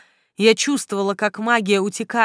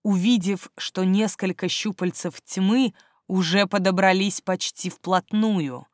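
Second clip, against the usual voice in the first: ordinary delivery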